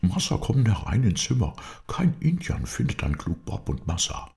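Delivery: high-pitched, animated character voice; Bob, eager helper. A narrator's reading. Bob invites his master into the hollow tree, eager high voice.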